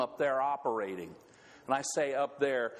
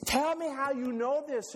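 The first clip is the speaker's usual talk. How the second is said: Lena's voice; 9 semitones higher than usual. Acted. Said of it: "And she's".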